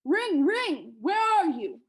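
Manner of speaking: high, loud giant's call; yelling. A narrator's reading. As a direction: yell out in search of something lost. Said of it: "Then he yelled".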